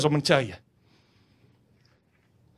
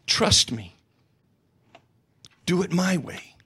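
lightly voiced God; 3 semitones lower, 6 dB quieter, slower than usual. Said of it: "And God's saying".

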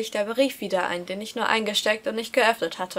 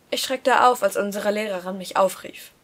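ordinary delivery

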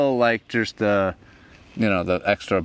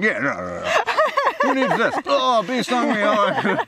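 high mocking voice; 14 semitones higher than usual. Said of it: "I'm like".

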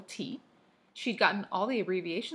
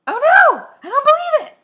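very high, full-force scream; piercing, loud panicked cry; 20 dB louder than usual. A narrator's reading. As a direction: scream it in utter disbelief. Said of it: "cried Mary Ann".

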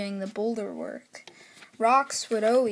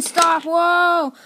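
loud higher cry of alarm; alarmed shout; 6 semitones higher, 9 dB louder than usual. Acted. Inspector watching the crash, loud alarmed shout. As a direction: yell for it to stop. Driver sees engine crash.

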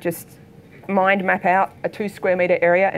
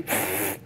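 pig snort; 11 semitones lower, 9 dB quieter than usual. Retold or imitated. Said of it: "and she's".

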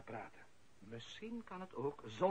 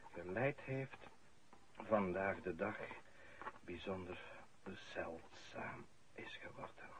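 solemn eulogy; 4 semitones lower, 5 dB quieter than usual. Acted. Priest at the funeral, a solemn eulogy. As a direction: speak as if over a grave, softly.

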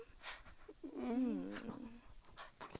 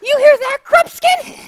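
loud, higher scornful call to the dwarf; mocking queen; 9 semitones higher, 11 dB louder than usual. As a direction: taunt him before your servant. Queen mocks to her dwarf.

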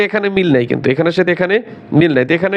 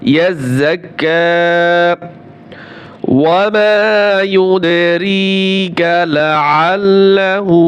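slow, melodic tajweed chant; Quranic recitation; much slower than usual. Shown as a demonstration. Teacher recites the verse after spelling it. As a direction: lengthen the vowels, recite prayerfully. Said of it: "কাফ খারা জবর কা".

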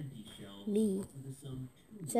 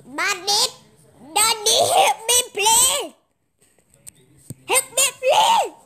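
put-on little kid voice; 7 semitones higher, 3 dB louder than usual. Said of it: "I did like".

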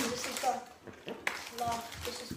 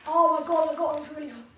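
loud exclaim; 3 semitones higher, 8 dB louder, faster than usual. Real, not acted. Reacting to burning spice.

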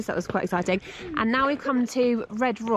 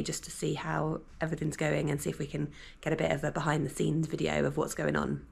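hushed early-morning talk, much softer; quiet; 4 semitones lower, 10 dB quieter than usual. Real, not acted. Parent filming at dawn before breakfast, talking hushed. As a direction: keep it low so as not to wake anyone.

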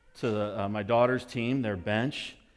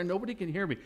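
ordinary talk, nothing marked

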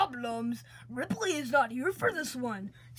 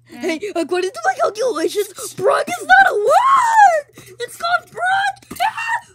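much higher, loud insistent yelling; ranting shout; 12 dB louder than usual. Acted. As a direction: yell it over and over, insisting. Character rants about Brock.

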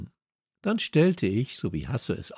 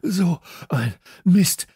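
slow, louder breathless curse; panting; 8 semitones higher, 8 dB louder, slower than usual. Acted. Kalle gasps after the chase, out of breath. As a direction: gasp it out of breath. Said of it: "keuchte Kalle".

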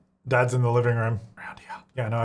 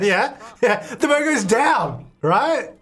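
laughing; 7 semitones higher, slower than usual. Real, not acted. Laughing while showing the game.

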